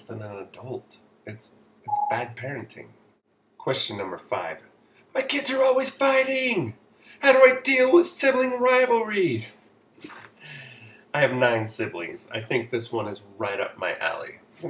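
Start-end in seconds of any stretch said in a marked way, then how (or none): 5.1-9.5 very high, loud monotone wail; mock exasperated parent, pitched way up; frazzled parent. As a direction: wail it high and loud, dropping only at the end. Acting out a frazzled parent's question.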